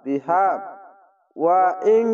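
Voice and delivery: বিহা drawn one alif, then stop; stretch then stop. Shown as a demonstration. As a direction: stretch the হা, pause. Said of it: "এক আলিফ টেনে থেমে যাবেন". (এক আলিফ টেনে থামা)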